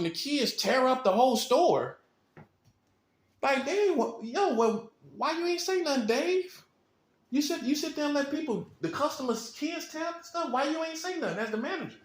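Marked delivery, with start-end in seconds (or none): 3.4-12.0 higher, exasperated customer calling out the manager; indignant customer. Acted. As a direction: complain to the manager like a fed-up shopper. Customer scolding the manager Dave.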